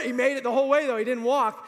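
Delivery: usual speaking voice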